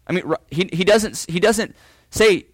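usual speaking voice

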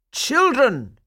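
high, loud call; cried out. A narrator's reading. Burgomaster hoping to get their attention, crying out. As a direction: call sharply over a noisy crowd. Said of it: "he cried".

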